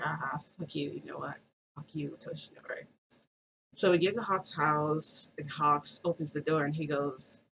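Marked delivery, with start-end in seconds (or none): 0.0-2.8 soft, private jab at someone out of earshot; under-the-breath cursing. Acted. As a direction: curse him quietly to yourself. Silent reply to watcher from car.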